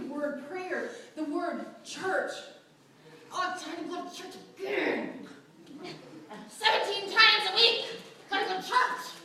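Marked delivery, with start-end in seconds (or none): 3.3-9.1 reluctant, whiny grumble; mock grumbling. Her past self grumbling about church, lightly mock whiny. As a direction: sulk about church like a bored kid. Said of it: "I wasn't feeling churchy".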